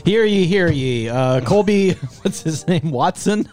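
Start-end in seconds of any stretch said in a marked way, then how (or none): 0.1-1.1 town crier. Acted. Mock court opening.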